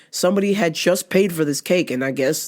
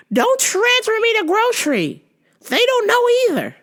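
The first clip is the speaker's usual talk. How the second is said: put-on much higher, fussy older lady on the phone; confused lady caller. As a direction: play the lost caller, pitch way up. Voicing the caller.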